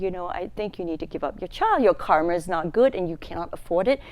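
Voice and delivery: ordinary delivery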